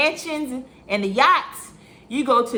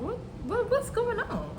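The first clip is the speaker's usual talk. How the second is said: puzzled; 12 semitones higher, 6 dB quieter than usual. Retold or imitated. Puzzled reaction on seeing police.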